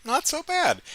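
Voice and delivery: high, mildly surprised inner voice; pleasantly surprised. Retold or imitated. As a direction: sound pleasantly caught off guard. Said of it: "I was like".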